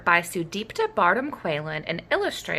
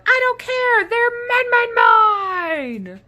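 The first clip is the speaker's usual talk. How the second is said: high, loud tantrum yell; a greedy dino yelling at the others; yelling Minosaur; 5 dB louder than usual. Acted. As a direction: shriek it like a spoiled kid. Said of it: "the Minosaur yelled".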